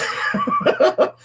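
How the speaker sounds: very high giggle; squealing laugh at oneself. Real, not acted. Host admitting possibly wrong facts, laughing.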